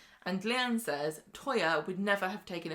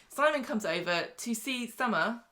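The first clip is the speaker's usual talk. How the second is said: ordinary talk, nothing marked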